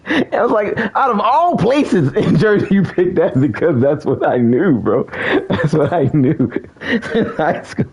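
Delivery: high-pitched, cracking up while talking; helpless high laughing speech; laughing hard. Real, not acted. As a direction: push the words out through laughter. Cracking up at chat's guess.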